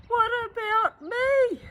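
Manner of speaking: very high, loud plaintive cry. Acted. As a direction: wail to be noticed. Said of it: "Old Truck cries".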